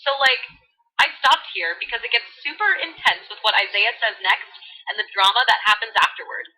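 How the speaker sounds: put-on bubbly valley girl voice. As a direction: read scripture like a valley girl. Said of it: "Valley Girl edition".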